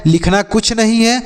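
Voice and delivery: usual speaking voice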